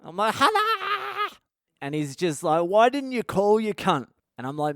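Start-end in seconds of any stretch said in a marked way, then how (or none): 0.4-1.4 very high holler breaking into a laugh; stretched, wavering shout of the name; yelled greeting. Retold or imitated. Friends trade yelled greetings. 2.6-4.1 high, loud, matey rebuke; raised-voice banter; mock telling-off. Friend's mock telling-off, acted.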